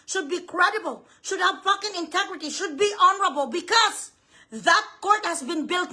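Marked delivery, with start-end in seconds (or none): none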